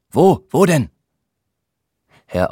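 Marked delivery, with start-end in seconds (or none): 0.1-0.9 high, louder anxious Wo, Wo denn; mock alarm. Dionysos hears a noise in the recital, hammed-up alarm. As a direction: jump at a noise in pretend panic. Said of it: "sprach in unterschiedlichen Stimmlagen".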